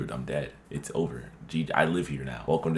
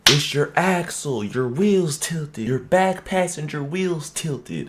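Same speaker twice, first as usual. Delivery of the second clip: high, slow imitation of the old local man; old man on four-wheeler; 11 semitones higher, 4 dB louder, slower than usual. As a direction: drag it out high like the old guy explaining the axle. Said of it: "And he's like".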